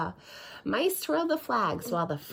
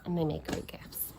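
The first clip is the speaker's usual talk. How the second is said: whispered aside; 2 semitones lower than usual. Real, not acted. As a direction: whisper it as a secret. Aside to viewers.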